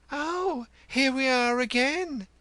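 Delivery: very high, sing-out greeting; coquettish, high-pitched perky welcome; vivacious. Acted. Housekeeper greeting a returning visitor at the door, vivacious. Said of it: "she said vivaciously".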